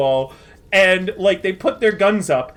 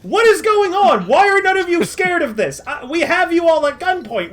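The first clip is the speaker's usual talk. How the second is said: baffled, high; 5 semitones higher than usual. Acted. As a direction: plead in total bewilderment. Gunman baffled nobody is scared.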